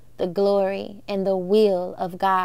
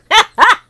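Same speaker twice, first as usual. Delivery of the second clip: loud laugh; 11 semitones higher, 9 dB louder than usual. Real, not acted. Laugh after defiant shout.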